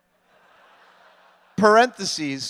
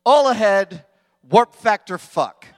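starship captain; 3 semitones higher, 9 dB louder than usual. Retold or imitated. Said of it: "the best one has to be Will's".

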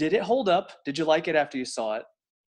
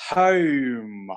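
Australian "Home" drawn out into three syllables; stretched Australian "Home"; 3 semitones lower than usual. Retold or imitated. Fan imitating an Australian film character, stretched Australian "Home". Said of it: "she stretches it out so it's, like, three syllables".

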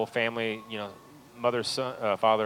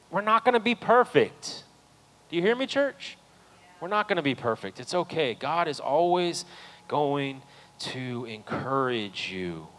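loud, high, fervent preaching to the church; full-force preaching; 10 semitones higher, 11 dB louder than usual. Real, not acted. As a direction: lift the room, preach it loud to the congregation.

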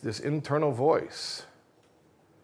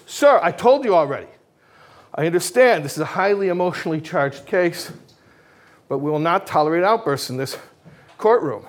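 louder, a bit higher exasperated scolding; stern rebuke; 5 semitones higher, 7 dB louder than usual. Acted. Judge scolding the heckler a second time, stern and louder. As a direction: lay down the law to the gallery. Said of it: "The judge says".

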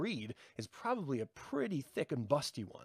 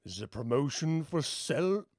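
mock ad read; 3 dB louder than usual. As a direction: pitch the soul deal like a commercial. Mock promo read for Satan.

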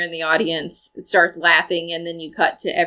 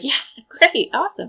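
higher, mock-pleased through a big grin; cheesy grinning; 7 semitones higher than usual. Retelling the pair hearing they're in, cheesy grinning. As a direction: imitate oblivious cheesy delight. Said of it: "huge cheesy grins".

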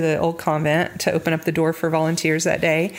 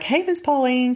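sweet greeting; 7 semitones higher than usual. Retold or imitated. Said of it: "that's how I talk to everybody".

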